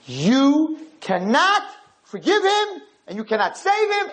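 high, slow insistent angel voice; pleading angels. Retold or imitated. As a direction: urge Hashem not to forgive. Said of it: "And they said".

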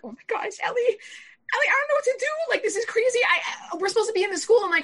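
high-pitched, loud panic; panicked pleading to brother, voice way up; freaking out. Retold or imitated. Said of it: "all of a sudden I start freaking out".

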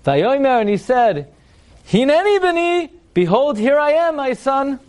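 very high, slow, grandiose reply; Masterpiece Theater declamation. Acted. Rabbi mimicking Abraham answering his son, hammily theatrical. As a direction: intone it like a hammy stage patriarch. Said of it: "What is this, Masterpiece Theater over here?".

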